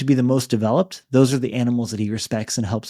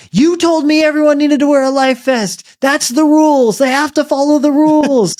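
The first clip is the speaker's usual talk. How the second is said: put-on very high, loud outraged child's yell; shrieked-up mock kid voice, insistent and loud; kid shouting; 13 semitones higher, 6 dB louder than usual. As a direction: imitate a three-year-old hollering in righteous protest. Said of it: "kid there who started shouting".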